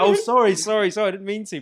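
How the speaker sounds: flustered apology. Retold or imitated. Commuter apologising to an offended passenger, flustered. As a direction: backpedal fast and sheepish. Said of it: "I was like".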